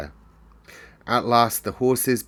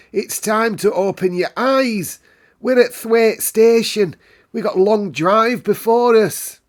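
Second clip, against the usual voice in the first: high, loud Yorkshire housekeeper; bossy housekeeper; 13 semitones higher, 9 dB louder than usual. Acted. Medlock rousing Mary on the train, high and loud. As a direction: hurry the sleepy child along.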